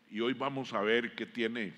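normal talking voice

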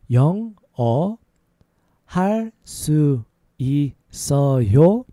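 every syllable held and set apart; slow demonstration. Shown as a demonstration. Teacher showing learners how to ask about English, slow. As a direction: spell it out syllable by syllable. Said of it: "A little faster".

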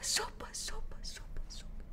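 whispered hush after the scream; fading whisper. Acted.

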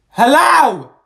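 loud, high hello; calling out. Acted. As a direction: call for attention. Calling teacher.

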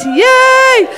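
high, drawn-out playful whoop; playful cheer. Pretend. Mock cheer for own Irish accent.

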